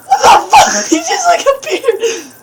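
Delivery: high, loud, laughing disbelief; shocked laughing shout. Real, not acted. Player laughs off the monster that just appeared, shocked laughing shout.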